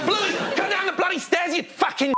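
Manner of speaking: put-on very high, strained shout up to the kids; over-enthusiastic parent losing it. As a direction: manic cartoon parent yelling for the children. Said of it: "they're enthusiastic as the".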